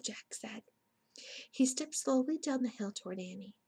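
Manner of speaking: usual speaking voice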